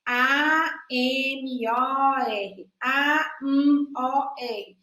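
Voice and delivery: "A, M, O, R" named one by one, evenly; letters spelled out. Shown as a demonstration. Teacher spells a word aloud so children see the letters. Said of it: "com as letras que formam as palavras".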